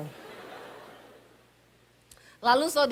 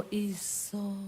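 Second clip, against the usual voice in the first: slow, much softer Javanese "Ojo nesu"; calm him down, lower and softer; soft Solo husband; 4 semitones lower, 10 dB quieter, slower than usual. Retold or imitated. Husband soothing his wife, soft slow Javanese.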